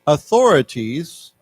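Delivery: slow, deliberate reading; reading aloud for class. Shown as a demonstration. Teacher reading the news text aloud to students, slow. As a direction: read each phrase slowly for the learners. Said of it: "let's read this together".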